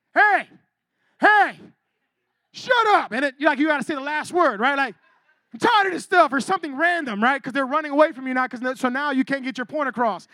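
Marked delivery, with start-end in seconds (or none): 0.1-3.1 loud, sharp angry yell; hammed-up yelling after someone; mock angry shout. Yelling at someone running away. 5.5-6.3 mock last-word yell.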